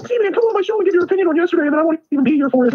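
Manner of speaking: very high put-on voice; mocking imitation. Co-host mocking a host who skipped his show, high put-on voice. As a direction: mimic him in a silly, squeaky high register.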